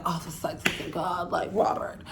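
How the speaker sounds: soft, half-whispered moping. Retold or imitated. Unhappy woman pining for her ex, soft moping murmur. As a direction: mope under her breath. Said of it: "She's like".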